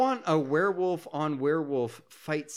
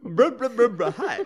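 laughing; 7 semitones higher, 7 dB louder than usual. Pretend. Laughing while repeating subtitle.

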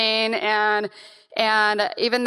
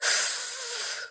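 mock slow calming breath like a child; a son's calming breath, imitated; imitated deep breath; 10 dB quieter than usual. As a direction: breathe out slowly, showing how he coached her. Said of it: "he goes".

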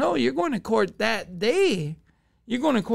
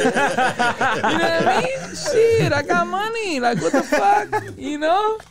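laughing through the words, higher and louder; 9 semitones higher, 4 dB louder, slower than usual. Real, not acted. Telling friends the story, breaking into laughs. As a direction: laugh it out, pitch rising.

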